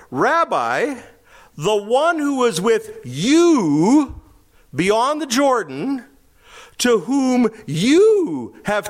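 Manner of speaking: slow and high, leaning on each "you"; "you" drawn out and pressed hard, voice up high; stress on you. Shown as a demonstration. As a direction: read it slowly, hitting every "you". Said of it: "So let's read it this way".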